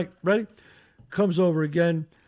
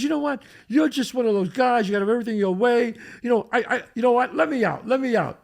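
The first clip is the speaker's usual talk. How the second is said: higher-pitched imitation of a fed-up date; mock indignant, pitched-up outburst; retold angry rant; 7 semitones higher, 3 dB louder, faster than usual. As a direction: act out her indignant car tirade. Retells date's angry rant in car.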